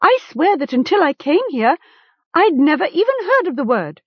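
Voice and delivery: high-pitched, urgent protest. A narrator's reading. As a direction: protest your innocence. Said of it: "Gom protested".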